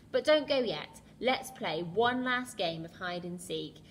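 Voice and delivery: loud, playful call to the animals; rally everyone for a game; excited call. Acted. Crocodile calls everyone to play.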